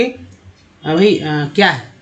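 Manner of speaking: ordinary delivery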